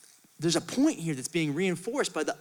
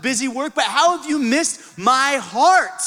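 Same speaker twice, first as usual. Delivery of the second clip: preaching at full force; 3 semitones higher, 4 dB louder than usual. Real, not acted. Preacher building to a peak over the music, fervent. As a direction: drive the point home, building.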